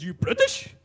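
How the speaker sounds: outraged high-pitched Afrikaner shout; loud, incredulous Afrikaner challenge; Afrikaner accent. Acted. Burly bar patron confronts a Brit, outraged Afrikaner accent. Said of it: "to which point he says".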